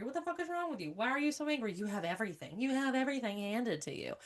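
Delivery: high-pitched mimicked reproach; whiny mock telling-off; mock scolding. Mimics people scolding angry women.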